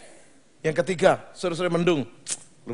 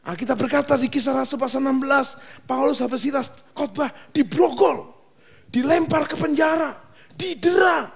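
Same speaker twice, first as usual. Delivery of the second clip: khotbah berapi-api; 11 semitones higher than usual. Acted. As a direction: preach it with rising force. (berkhotbah dengan semangat tinggi)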